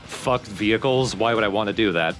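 normal talking voice

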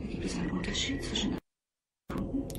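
soft hushed question; whisper. Acted. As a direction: murmur it under the breath. Sprecherin flüstert durchgehend. (leise geflüsterte Frage)

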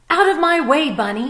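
a fox shoving past in a hurry; rushing fox. Acted. Fox in a rush.

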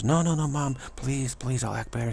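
high, urgent begging to mom; pleading child. Acted. Child begs mom, threatened with punishment.

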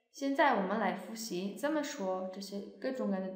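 usual speaking voice

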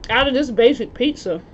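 speaking between bites; chewing while talking. Real, not acted. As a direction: mouth partly full. Seen 3: Cheek bulges and chewing lips across frames; eating pizza throughout.

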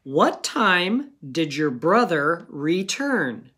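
what time did your brother return said slowly, word by word; slow dictation. Shown as a demonstration. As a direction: read the sentence slowly, as dictation. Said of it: "here's your sentence".

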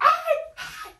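sudden high, loud yell of a name; retold yell. Stylist calling across the street, loud high yell. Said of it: "She yelled out the name".

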